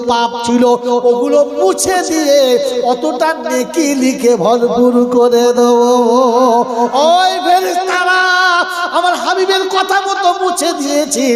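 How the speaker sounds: loud, driving sermon voice at full force; fiery preaching. Acted. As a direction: preach it out to the crowd, high and loud. Preacher delivering quoted scripture story.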